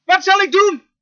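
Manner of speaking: loud, high-pitched cry for help. Acted. Preacher acting Moses pleading with God, crying out. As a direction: call out urgently to heaven.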